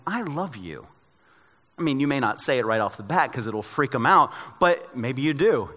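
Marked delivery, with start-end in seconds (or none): 0.1-0.9 softer, a gentle I love you; tender I love you. Pretend. To the person you fight with, a soft tender I love you. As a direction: say it like easing a quarrel. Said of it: "if you go in going".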